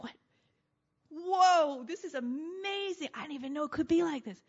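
amazed. Retold or imitated. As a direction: burst out in delight. Newcomer amazed in Christ.

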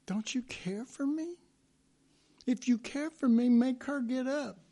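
Martha complaining. Retold or imitated. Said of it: "she said that to Jesus".

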